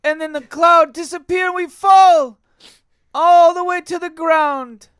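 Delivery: high, loud Italian plumber voice; Mario. Pretend. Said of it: "it's me, Mario".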